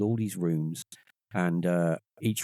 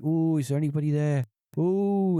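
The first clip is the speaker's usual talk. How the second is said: hammed-up ghost-calling, pitched up and held long; mock spooky séance; 6 semitones higher, 4 dB louder than usual. Skeptical mate having a go at the EVP, mock spooky.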